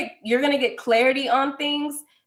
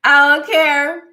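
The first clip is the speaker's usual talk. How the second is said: "I don't care" shouted louder and higher; defiant shout; 5 semitones higher, 10 dB louder, slower than usual. Real, not acted. Repeats line, then louder.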